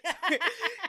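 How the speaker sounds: high burst of giggling; high laughter. Real, not acted. Giggling fit on podcast.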